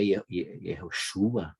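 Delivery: ordinary delivery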